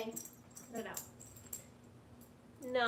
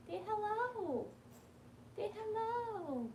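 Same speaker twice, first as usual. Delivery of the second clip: coaxing pet talk; 8 semitones higher, 4 dB quieter, slower than usual. Real, not acted.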